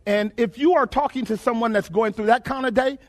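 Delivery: ordinary talk, nothing marked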